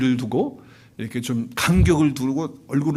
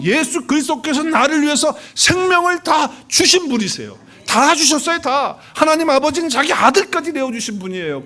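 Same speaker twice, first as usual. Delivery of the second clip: loud, higher, impassioned sermon voice; fervent preaching; 11 semitones higher, 6 dB louder than usual. Acted. Pastor urges the congregation to express love to God, fervent.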